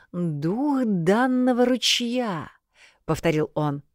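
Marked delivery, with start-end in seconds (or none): 0.1-2.5 slow, tasting each word; savoring the words. Acted. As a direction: roll the phrase slowly on the tongue. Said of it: "покатал эти слова у себя на языке".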